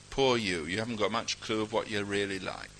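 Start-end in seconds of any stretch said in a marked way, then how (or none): none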